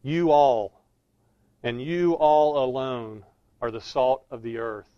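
"You all" hit loud, then "you all alone" drawn out; emphatic plural YOU. Shown as a demonstration. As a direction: lean hard and slowly on "you all alone". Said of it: "so it has this sense".

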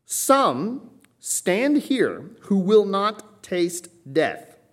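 very slow, high, loud, word by word; emphatic proclamation. Acted. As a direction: set each word apart up to a falling death. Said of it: "he's highlighting this".

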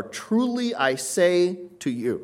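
TRULY said high, slow and weighty; preacher stressing "Truly I say to you" for emphasis; emphatic preface. Shown as a demonstration. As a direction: lean hard on "Truly" and draw the phrase out. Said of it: "prefaces that Jesus will use for emphasis".

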